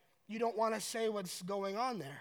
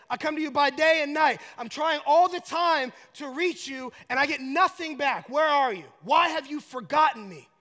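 loud, high-pitched cry of complaint; acted exasperated prayer; desperate plea; 5 semitones higher, 10 dB louder than usual. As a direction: demand an answer from God. Acts David pleading with God.

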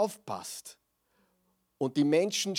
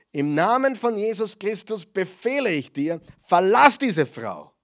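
commanding exorcism; 6 semitones higher, 9 dB louder than usual. Retold or imitated. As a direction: order the demon out with full authority. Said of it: "sagte zu dem Geist".

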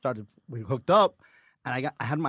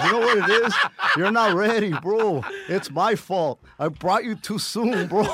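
louder, higher mock advice; imitating a friend; 5 semitones higher, 6 dB louder than usual. Retelling what the friend said on the road, mock consoling. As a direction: lightly voice the buddy consoling him. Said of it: "He goes".

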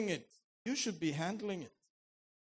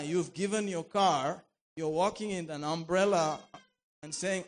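ordinary talk, nothing marked

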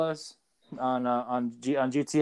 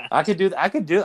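normal talking voice

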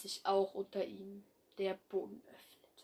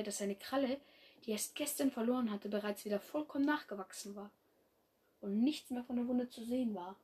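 usual speaking voice

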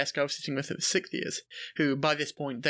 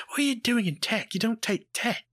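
high-pitched, incredulous questioning; puzzled friend; 7 semitones higher than usual. Retold or imitated. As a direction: imitate a baffled mate challenging you. Friend puzzled at finding him there.

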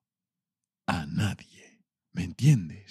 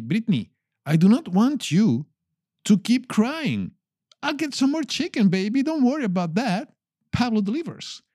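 put-on high, cooing comfort to a crying girlfriend; sweet talk; 8 semitones higher than usual. Boyfriend comforting his crying date, high cooing. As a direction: soothe her like a smitten boyfriend. Consoling crying Britney in a story.

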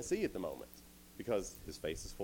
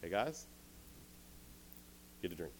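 offhand quiet call; 3 semitones lower, 9 dB quieter than usual. Retold or imitated. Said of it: "And Gideon goes".

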